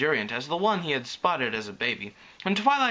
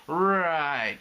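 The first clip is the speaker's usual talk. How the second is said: long sceptical drawl; 3 semitones higher, 3 dB louder than usual. A narrator's reading. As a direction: stretch it out, unimpressed. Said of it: "she drawled".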